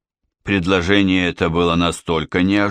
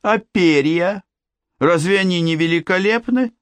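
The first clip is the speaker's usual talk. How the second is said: admiring king; 10 semitones higher than usual. Acted. Father praising his daughter to the suitor, high doting marvel.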